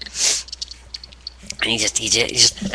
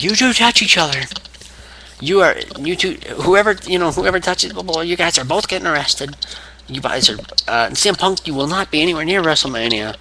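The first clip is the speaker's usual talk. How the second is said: higher-pitched mock authority; imitated boss warning; 6 semitones higher than usual. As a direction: lay down the law in a raised pitch. Acted warning from the boss in ring.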